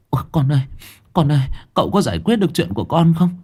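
mother begging to know if her son is saved, frantic. Acted. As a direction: rush the words out in panic. Mother anxiously checks her son.

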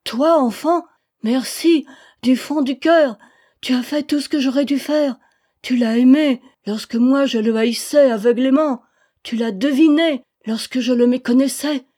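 loud, high, fervent outpouring; exalted. A narrator's reading. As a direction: pour out gratitude with exaltation. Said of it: "avec exaltation".

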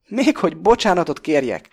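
high, loud incredulous retort; indignant outrage. A narrator's reading. Pakó rages at Krestopulosz, high and loud. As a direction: bristle with outrage. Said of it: "háborog Pakó".

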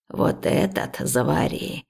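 put-on low, very slow old woman's voice; unhurried instruction from an elder; old herbalist. A narrator's reading. Old woman hands over herbs, low and slow.

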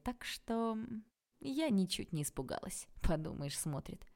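plain reading voice

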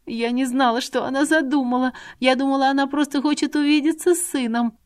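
high, rising tearful pleading; a frightened young girl sobbing out excuses; a young girl explaining through tears, high and rising. Acted. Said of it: "Ариана заплакала сильнее".

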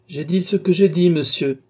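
scornful, unyielding reply; contemptuous. A narrator's reading. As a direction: stand your ground with scorn, pitch up. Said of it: "dit le docteur avec mépris".

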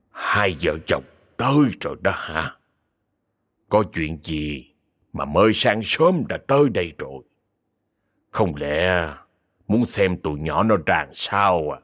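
put-on low, unhurried voice of an old sage; calm old master. Old master greets the couple. (giọng ông thầy già trầm, chậm rãi)

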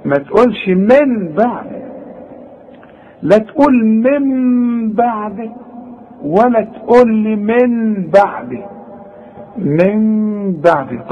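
من بعد with the ghunna drawn from the nose, slow; nasal ghunna. Shown as a demonstration. Quran teacher demonstrating the nasal ghunna to students. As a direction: hold the nasal hum on the noon in من بعد, slowly. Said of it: "طلع لي الغنة من الخيشوم".

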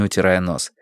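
usual speaking voice

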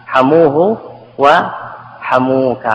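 حموه وحموك drawn out, the vowel of the k clear; very slow, spell out the suffix in حموك; slow, careful. Shown as a demonstration. To students, showing the masculine suffix forms. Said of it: "يذكر الضمير ويفتح الكاف".

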